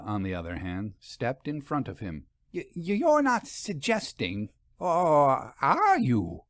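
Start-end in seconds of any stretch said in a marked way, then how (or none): 2.5-6.5 stammering. A narrator's reading. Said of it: "she stammered".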